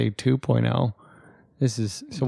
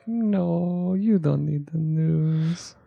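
higher, slow patronising refusal; mock device voice; 5 semitones higher, much slower than usual. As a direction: talk down to her like a smug gadget. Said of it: "they're like".

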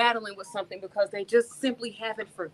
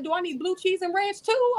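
mock confusion; 11 semitones higher than usual. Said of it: "And I'm like".

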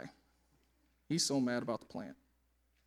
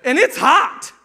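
Jonah's angry reply; 9 semitones higher, 16 dB louder than usual. Acted. Jonah complaining to God about his dead plant, loud and indignant. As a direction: act out Jonah snapping back at God. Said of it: "He says".

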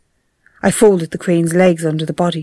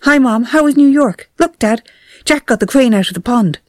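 put-on eager boy; 6 semitones higher than usual. A narrator's reading. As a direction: show off the bird to the parents. Boy greets parents with bird.

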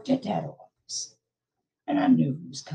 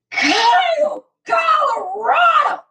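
drawn-out scream, much higher and louder; screaming a name; 9 dB louder, slower than usual. Acted. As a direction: wail the name out at full volume, slowly. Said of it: "You make me scream your name".

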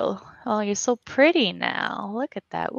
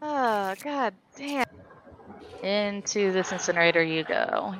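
ordinary talk

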